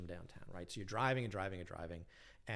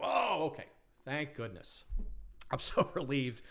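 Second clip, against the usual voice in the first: relieved exclaim; 5 semitones higher, 4 dB louder than usual. Retold or imitated. Relief after cab confusion.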